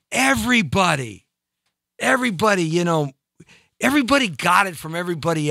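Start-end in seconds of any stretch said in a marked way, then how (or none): none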